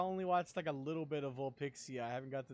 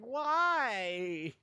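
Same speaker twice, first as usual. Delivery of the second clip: incredulous why; 9 semitones higher, 9 dB louder than usual. Retold or imitated. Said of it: "And I'm like".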